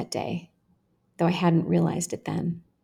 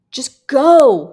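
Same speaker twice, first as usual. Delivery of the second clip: loud, higher, forceful push; grunted urging; 7 semitones higher, 11 dB louder than usual. A narrator's reading. One guy groping her urges another, grunted urging. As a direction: shove the words out hard. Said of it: "one guy grunted".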